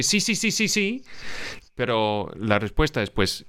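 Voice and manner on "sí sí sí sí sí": mock eager yes. Mocking everyone's empty promises.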